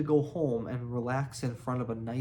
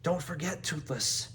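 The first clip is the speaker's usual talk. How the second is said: pushed out through clenched teeth; through gritted teeth; 3 semitones higher, 3 dB quieter than usual. A narrator's reading. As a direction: speak without opening the jaw. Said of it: "said Hiccup through gritted, through, through gritted teeth".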